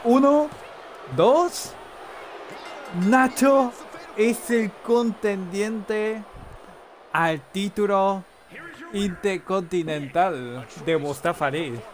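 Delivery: slow, high, drawn-out ring announcement; wrestling announcer. Acted. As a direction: stretch each word like a ring announcer. (anunciador de lucha libre, lento y alargado)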